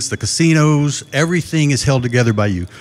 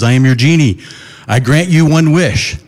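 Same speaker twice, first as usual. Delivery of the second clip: grand, slowed genie voice, louder; booming genie; 3 semitones higher, 7 dB louder than usual. Retold or imitated. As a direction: boom it out like a giant genie. Giant genie appears and speaks.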